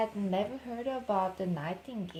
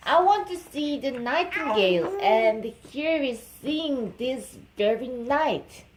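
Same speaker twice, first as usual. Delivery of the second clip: loud, high commanding king; 5 semitones higher, 8 dB louder than usual. Acted. King orders his head servant, a loud, high demand. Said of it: "and said to him".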